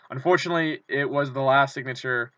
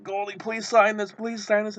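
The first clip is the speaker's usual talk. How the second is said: pleading fan; 8 semitones higher than usual. Retold or imitated. Begging player for autograph.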